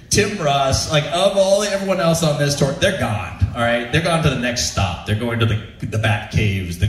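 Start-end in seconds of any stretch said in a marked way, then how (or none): none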